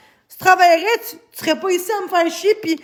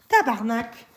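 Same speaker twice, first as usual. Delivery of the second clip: ordinary talk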